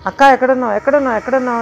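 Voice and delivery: calling out. Retold or imitated. Search party calling for her.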